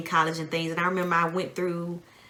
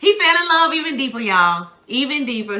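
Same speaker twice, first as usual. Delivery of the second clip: teasing; 6 semitones higher, 8 dB louder than usual. Real, not acted. Wife teasing loudly.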